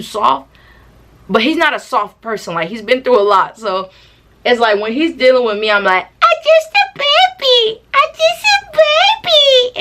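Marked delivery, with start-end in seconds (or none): 6.2-9.8 put-on very high, slow baby squeal; hammed-up squeaky baby talk; mock baby voice. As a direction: whine it like a tiny baby. Acts babyish while being scolded.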